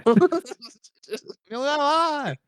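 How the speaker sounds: high-pitched mock-Chinese angry yelling; screaming angry Chinese impression. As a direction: yell angrily in a caricatured Chinese accent. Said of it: "the screaming angry Chinese".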